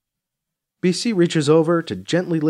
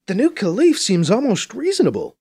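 higher voice, mildly surprised; hint of surprise; 8 semitones higher than usual. A narrator's reading. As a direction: sound pleasantly taken aback. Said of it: "with a hint of surprise in her voice".

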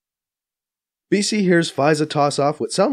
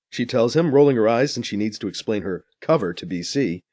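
ordinary delivery, nothing marked

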